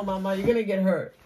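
ordinary talk

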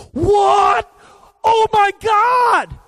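very high, loud, slow shocked yell; super animated shriek. Retold or imitated. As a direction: scream it like terrible news. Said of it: "Marcus is super animated".

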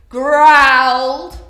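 very loud, drawn-out growl on the word. Pretend. Storyteller acting out the crocodile, growling. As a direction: make "growled" a long growl.

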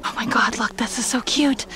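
excited whisper. Real, not acted. Shopper whispering to the camera about a cute find. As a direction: hush it like a secret find.

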